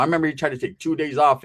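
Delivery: ordinary talk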